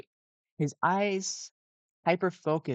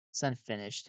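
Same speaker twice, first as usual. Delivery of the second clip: usual speaking voice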